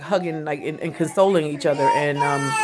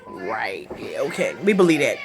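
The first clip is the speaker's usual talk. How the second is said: sarcastic disbelief; 8 semitones lower than usual. Real, not acted. Mocking OJ's confession tape, dry sarcastic aside. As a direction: roll your eyes at the story. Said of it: "mocking OJ's story".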